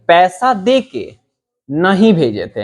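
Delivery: slow "पैसा दे के", then a break before "नहीं भेजे थे"; pause after के. Shown as a demonstration. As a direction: split it into two phrases after "के". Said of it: "हमको ये लगा". ("के" के बाद रुककर)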